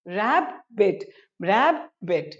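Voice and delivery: "रैबिट" broken into rab, pause, bit; split syllables. Shown as a demonstration. Teacher drilling two-syllable words, "रैबिट" split as rab - bit. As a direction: say each "रैबिट" in two separate beats. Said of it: "दो syllable से बने हैं".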